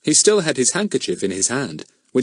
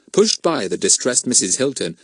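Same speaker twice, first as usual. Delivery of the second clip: ordinary delivery, nothing marked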